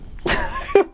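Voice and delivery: very high squeal of laughter; giggle fit. Real, not acted. Reader failing to keep going, helpless high giggle. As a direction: let a squeaky laugh burst out. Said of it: "Maybe you're just very giggly".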